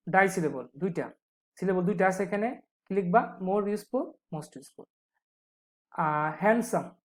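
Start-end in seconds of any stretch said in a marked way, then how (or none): none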